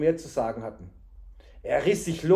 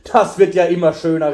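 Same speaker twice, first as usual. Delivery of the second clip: loud, scornful cry of disbelief; angry man shouting at the boy; indignant outburst; 2 semitones higher, 14 dB louder than usual. A narrator's reading. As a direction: blast it out, outraged. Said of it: "rief der Mann".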